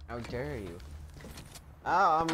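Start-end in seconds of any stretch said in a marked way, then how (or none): 0.1-0.8 much softer, deflated how dare you; muttered how dare you. Pretend. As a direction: say it under your breath. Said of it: "I'm about to say".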